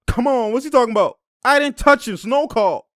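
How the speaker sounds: hammed-up outraged denial; high mock complaint. Mocking the player yelling at the ref, high and whiny. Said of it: "He's like".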